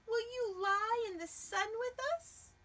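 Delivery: high cat inviting squirrel. Acted. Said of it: "said Cat".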